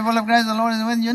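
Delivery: gabbled Hail Mary by rote; rattled off fast. Shown as a demonstration. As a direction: rush through it like a bored churchgoer. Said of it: "I can say it very fast".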